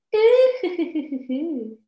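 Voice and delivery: high, dainty tittering laugh; put-on posh titter, starting high; posh teacup laughter. Shown as a demonstration. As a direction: laugh very poshly over a teacup. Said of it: "a very posh laughter sound".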